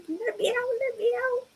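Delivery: high, fast pleading dog voice; the dog whining to get out to Jeff; voicing the dog. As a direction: beg to be let out like the dog. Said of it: "So he's like".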